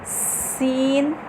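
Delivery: "सीन" thin with a drawn hiss, very long and soft; thin whistled seen. Shown as a demonstration. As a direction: whistle through "सीन" slowly. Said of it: "बारीक पड़ा, सीटी भी है इसमें।". ("सीन" बारीक, सीटी के साथ)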